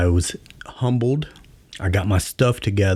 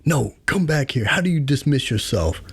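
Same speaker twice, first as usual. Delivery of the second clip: sergeant major; 8 semitones higher than usual. Retold or imitated. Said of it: "He was like".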